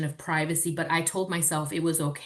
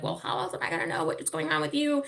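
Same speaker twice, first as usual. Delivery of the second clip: higher, quick defensive retort; exasperated retort; 9 semitones higher, faster than usual. Retold or imitated. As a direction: fire back at the daughter, pitched up and quick. Retorting to daughter.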